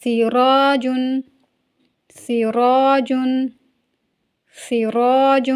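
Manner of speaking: سراج drawn out, with a full heavy ra; Urdu: سراج میں را موٹا. Shown as a demonstration. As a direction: stretch سراج slowly and thicken the ra. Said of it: "میرے ساتھ ساتھ پڑھیے".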